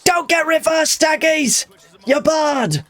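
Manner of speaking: very high, slow shouted landlady voice; imitating a pub landlady. Landlady in the corner yelling at her fighter, very high mock shout. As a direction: yell like a furious landlady barring him. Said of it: "She's like".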